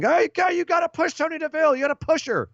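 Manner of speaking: high-pitched mock yell of fans; mock screaming. Fans clamoring at the company for a push, mock screaming. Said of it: "we're screaming".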